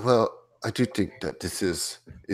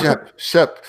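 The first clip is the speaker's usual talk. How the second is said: calling out; 6 semitones higher, 5 dB louder than usual. Acted. Calling the player's character.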